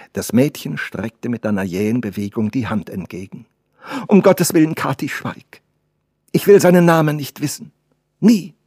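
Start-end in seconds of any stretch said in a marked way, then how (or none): 4.1-8.5 high, loud, urgent protest; raised voice, forbidding; agitated girl. Acted. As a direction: cut the servant off vehemently. Girl forbids the servant to speak.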